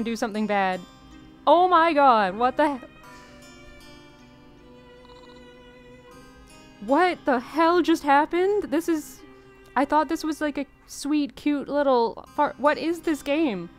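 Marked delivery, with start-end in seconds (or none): 1.5-8.6 loud, high shocked outburst. Real, not acted. Shocked by the demon summoning. 8.6-13.7 confused disbelief. Real, not acted. Confused by the dark turn.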